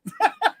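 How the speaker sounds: short high laugh; real laugh. Real, not acted. Host admitting it is not ready, laughing. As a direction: give a quick high laugh.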